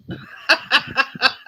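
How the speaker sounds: short breathy laugh; quick airy chuckle. Real, not acted. Host chuckling to Johnny about being left alone.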